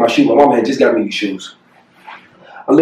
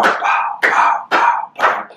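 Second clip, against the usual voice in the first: breathy pow pow of a gun going off; whispered gunshot noises; 3 dB quieter, much slower than usual. Retold or imitated. Retelling the party shooting, soft mock gunshots. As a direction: mimic the shots under your breath.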